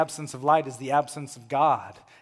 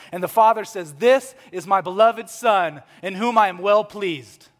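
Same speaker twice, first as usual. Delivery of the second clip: raised, louder preaching voice; impassioned preaching; 6 semitones higher, 9 dB louder than usual. Real, not acted. Preacher proclaiming heaven's announcement of Jesus, impassioned. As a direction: preach it out with rising fervour.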